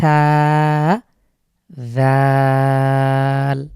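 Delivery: সা then জাল, loud and held very long; Arabic letters demo. Shown as a demonstration. Teacher comparing সা and জাল for viewers, long loud demo. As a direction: sustain each letter name slowly. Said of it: "ঠিক যেরকম সা".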